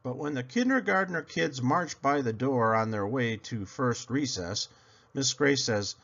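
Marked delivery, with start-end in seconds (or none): none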